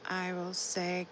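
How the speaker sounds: ordinary delivery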